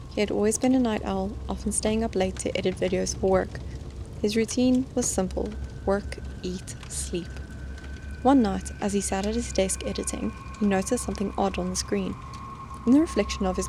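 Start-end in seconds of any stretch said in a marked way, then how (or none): 6.4-7.3 hushed, breathy whisper. A narrator's reading. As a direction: drop to a whisper. Narrator drops to a whisper.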